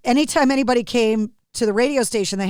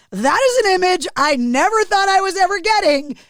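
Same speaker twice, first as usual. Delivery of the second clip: higher-pitched mock astonishment; amazed disbelief; 7 semitones higher, 3 dB louder than usual. Radio host reacting to Bruce's kid, in amazed disbelief. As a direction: exclaim it high, retelling your disbelief.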